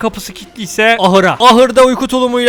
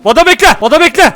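sharp loud command, much higher; urgent shout; 6 semitones higher, 5 dB louder than usual. Real, not acted. Ordering Vada to wait during the wolf attack, shouting.

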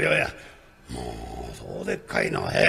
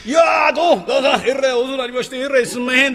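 pitched up, loud and fast; loud greeting; 5 semitones higher, 5 dB louder, faster than usual. Acted. Servant greeting the master upstairs, loud and fast. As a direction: gush out a loud thanks. Rakugo character greeting loudly.

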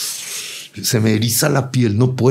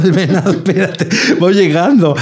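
high, laughing protest through giggles; laughing quote; 9 semitones higher, 4 dB louder than usual. Retold or imitated. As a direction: laugh while begging to stay. Retold self protesting at party. (riendo, voz aguda de protesta)

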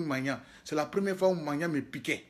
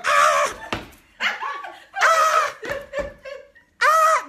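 loud pained scream, rising high; retold scream; 5 dB louder, slower than usual. Man bitten by ants in the shower, screaming loud. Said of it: "J'ai fait un comme ça".

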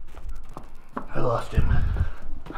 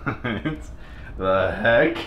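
ordinary talk, nothing marked

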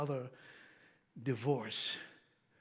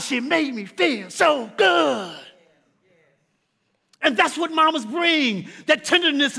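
loud, high preacher's build; full-force preaching; 9 semitones higher, 8 dB louder than usual. Acted. Preacher rousing the congregation about mamas, loud preaching.

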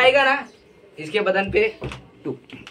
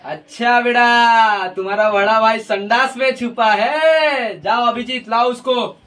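slow, theatrical detective drawl; hammed-up police boss giving an order; TV inspector; slower than usual. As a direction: stretch the ends of phrases with mock authority. Inspector role in filmed skit take.

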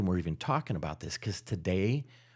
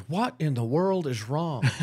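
higher-pitched mock bafflement; baffled husband; 6 semitones higher than usual. Husband finding his wife bawling, mock bafflement. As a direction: play the bewildered husband.

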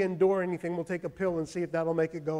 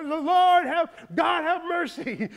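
high, loud desperate cry to God; 10 semitones higher, 8 dB louder, slower than usual. Retold or imitated. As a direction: plead out loud for mercy. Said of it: "He was crying out".

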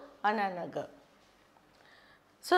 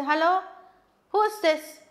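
loud, higher, curt demand; rude phone manner; 4 semitones higher, 5 dB louder than usual. Shown as a demonstration. A teacher acting a rude caller to students, loud and curt. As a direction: snap who's this like bad manners. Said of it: "This is very bad manners".

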